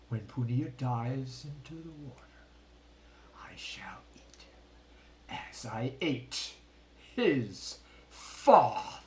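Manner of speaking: slow scheming whisper; menacing hushed voice; sinister whisper. A narrator's reading. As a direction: whisper the evil plan. King plots in secret.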